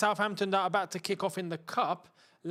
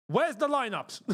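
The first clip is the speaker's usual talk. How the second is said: normal talking voice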